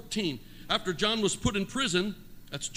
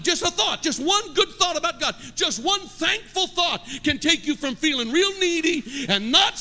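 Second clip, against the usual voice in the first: full-force preaching; 4 semitones higher than usual. Acted. Preacher urging the congregation to be thankful, full force. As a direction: preach it hard, building to a shout.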